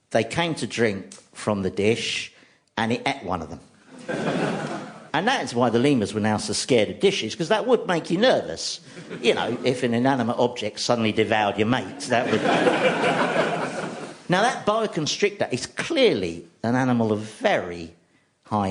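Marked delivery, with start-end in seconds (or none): 4.1-4.9 short laugh. Real, not acted. Laughing at own joke. 12.5-14.2 breathy high giggle at his own joke; amused laughter after the punchline; real laugh. Real, not acted.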